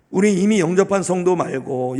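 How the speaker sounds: ordinary talk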